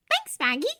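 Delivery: put-on high, bright girl's voice; bubbly young woman; cheerful young woman voice. A narrator's reading.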